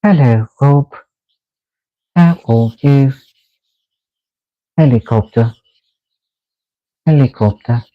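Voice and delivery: high-pitched mock robot with a thick accent. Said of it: "robot has an accent".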